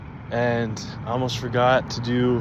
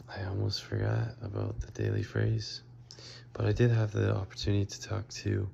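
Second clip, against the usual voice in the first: quiet; 6 dB quieter than usual. Real, not acted. Late night tired recording.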